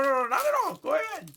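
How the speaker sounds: normal talking voice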